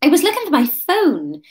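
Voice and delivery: stall, then improvise a cover story; thinking quickly. A narrator's reading. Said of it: "thinking quickly".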